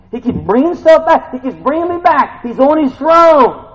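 loud, high, emphatic declaration; forceful preacher's exclamation; rousing preaching. Real, not acted. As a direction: drive each phrase home to the congregation.

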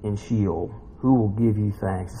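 low, measured psalm reading; solemn scripture reading. Acted. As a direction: read the lament out gravely. Reads psalm aloud.

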